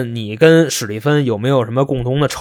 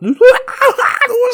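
high mock wailing cry; a loud, exaggerated sob; hammed-up wail; 4 dB louder than usual. Gary bursts out wailing at the police station, hammed-up wail. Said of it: "这个人就哭了".

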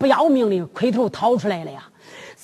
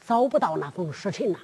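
怯声回禀；声音压低、小心翼翼地禀报老爷；像怕挨骂的差役，轻声说；差役怯生生地低声回话; 5 semitones lower, 8 dB quieter than usual. Acted. 贞观低声回禀.

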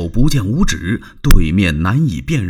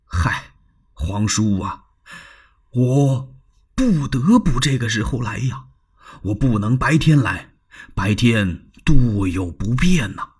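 secretive Dong Cheng. Acted. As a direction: confide in a hushed voice. Dong Cheng voiced in story. (压低声音的国舅)

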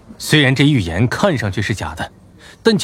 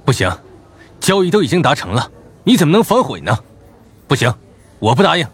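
firm refusal; 4 semitones higher, faster than usual. Acted. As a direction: flatly refuse to back down. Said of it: "坚决地说道".

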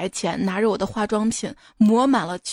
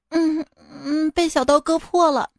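put-on higher, small child's voice; little girl voice; 6 semitones higher than usual. Daughter telling her mother about the cut, small child voice.

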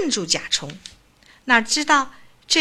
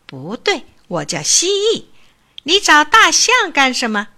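curious; 5 semitones higher, 6 dB louder than usual. A narrator's reading. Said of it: "蜥蜴感兴趣地问".

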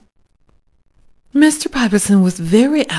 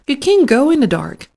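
urgent protest; 7 semitones higher, 5 dB louder, faster than usual. A narrator's reading.

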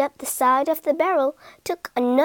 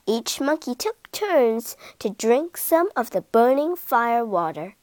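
ordinary delivery

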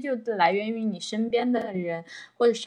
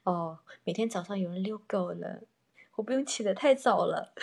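ordinary talk, nothing marked

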